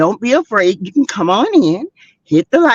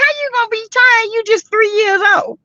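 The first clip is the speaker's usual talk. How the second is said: much higher, fast, mock disbelief; incredulous retort; 13 semitones higher than usual. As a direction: scoff at the tired toddler, high and quick. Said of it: "I'm like".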